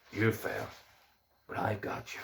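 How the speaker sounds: put-on soft, low Irish accent; quiet Irish-accented horse. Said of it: "or in the Irish accent".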